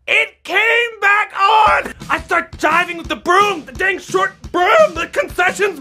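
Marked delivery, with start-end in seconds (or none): none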